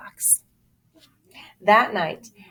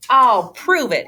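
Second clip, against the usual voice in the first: louder, higher stubborn vow; defiant boast; 5 semitones higher, 7 dB louder than usual. Acted. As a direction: declare it to win the argument. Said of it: "he said".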